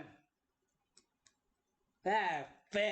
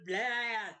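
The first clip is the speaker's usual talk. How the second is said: ordinary talk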